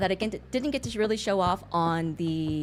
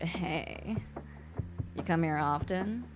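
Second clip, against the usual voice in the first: low, much softer smooth flirt; mock flirty pickup line; 5 semitones lower, 9 dB quieter, slower than usual. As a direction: lean in and murmur the pickup line. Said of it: "And I'm just like".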